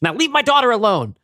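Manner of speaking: loud high furious scolding; angry mother. Acted. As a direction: scold him like an outraged mother. Mother threatens online friend.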